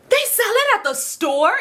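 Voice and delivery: high, drawn-out disbelief; mock-shocked high question; incredulous. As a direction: squeal the question slowly in disbelief. Shocked thought on hearing the news.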